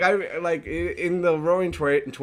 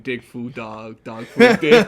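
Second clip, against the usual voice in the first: lightly voiced LA homie slang, ending louder with a laugh; mock LA slang; 3 semitones lower, 8 dB louder than usual. Co-host mocking LA slang with a laughing parody. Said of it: "six hours of just saying".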